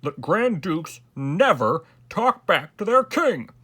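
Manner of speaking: loud, slow, emphatic scolding; stern. A narrator's reading. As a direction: hammer out each word as a royal lesson. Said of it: "his majesty said sternly".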